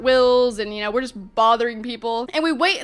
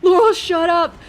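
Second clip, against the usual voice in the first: high, loud, exasperated order; forceful hushing; 5 semitones higher, 7 dB louder, slower than usual. Retold or imitated. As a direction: burst out to silence a friend. Urging friend again, more forcefully.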